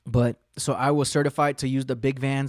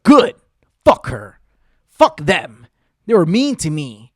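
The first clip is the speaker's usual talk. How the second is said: loud, punchy retold verdict; vindicated outburst; 10 dB louder, slower than usual. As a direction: punch out each short phrase loudly. Reacts to the house being banned.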